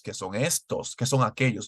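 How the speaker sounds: normal talking voice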